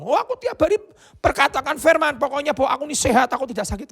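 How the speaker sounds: high, chirpy self-justifying; defensive excuse. Retold or imitated. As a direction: brush it off brightly. Pastor's defensive reply.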